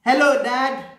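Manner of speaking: put-on high, loud little-boy hello; cheerful child calling to his dad; little boy greeting. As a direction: chirp it up like an eager small child. Reading Bernard's greeting aloud.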